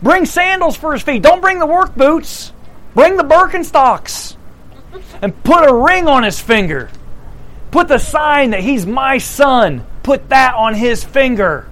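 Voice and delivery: a hint of a delighted father; eager father.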